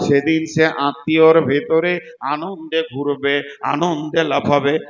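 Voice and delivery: loud, emphatic Bengali sermon delivery; full-force preaching. Acted. Preacher warning the crowd of the hard day, full-force preaching.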